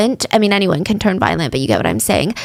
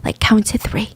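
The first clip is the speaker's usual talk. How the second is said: retold whisper, softer, voiceless; hushed countdown cue; 6 dB quieter than usual. Police cueing each other before opening the wardrobe, whispered. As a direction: whisper it as if plotting quietly.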